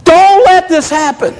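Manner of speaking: crying out. Retold or imitated. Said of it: "he's crying out to God".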